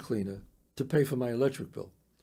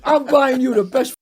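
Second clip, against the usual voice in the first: laughing excited; 7 semitones higher, 4 dB louder than usual. Retold or imitated.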